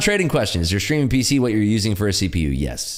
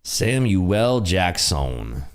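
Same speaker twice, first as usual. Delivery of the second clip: drawn out; much slower than usual. Pretend. Drawn-out name joke.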